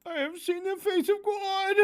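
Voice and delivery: high, overblown awestruck voice; high, overwrought mock-reverent voice; mocking grandiose quote. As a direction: ham up the profound line. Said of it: "Write better dialogue".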